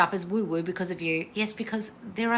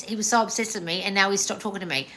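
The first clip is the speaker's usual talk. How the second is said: ordinary delivery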